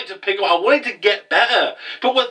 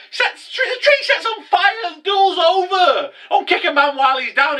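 incredulous exclamation; 13 semitones higher than usual. Real, not acted. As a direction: exclaim it like you can't believe the show did this. Outraged at the burning tree scene.

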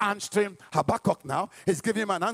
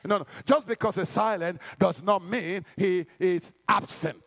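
ordinary delivery

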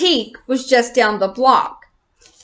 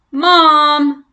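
louder, higher call for mom; shouting; 3 semitones higher, 6 dB louder than usual. Acted. Maya calling her mom about the intruders, shouting. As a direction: call out for help across the trailer. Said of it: "Maya shouted".

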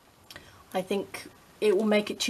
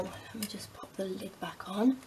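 whispering; 7 dB quieter than usual. Real, not acted. Quiet aside while crafting.

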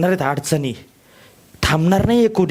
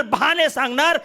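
usual speaking voice

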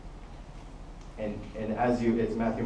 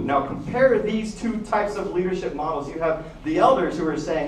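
ordinary delivery

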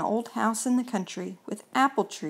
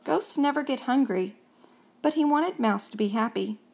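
usual speaking voice

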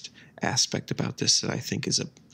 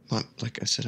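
usual speaking voice

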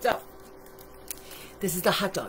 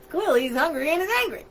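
ordinary delivery, nothing marked

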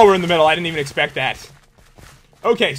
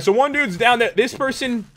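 ordinary talk

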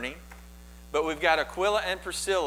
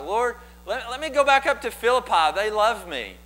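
high, louder mock plea to God; imploring, raised voice; pleading; 7 semitones higher, 5 dB louder than usual. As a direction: beg to be let go, pitched well up. Acting out Paul pleading with God.

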